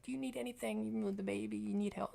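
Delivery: lightly voiced worried old lady; caring old lady. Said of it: "The old lady being, you know, caring is like".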